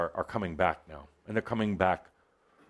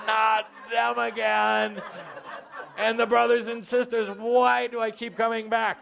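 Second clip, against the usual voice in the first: much higher, hammed-up whine; mock whine; 10 semitones higher than usual. Imitating the audience dreading family in a high whine. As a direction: squeal it in mock despair. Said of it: "you go into".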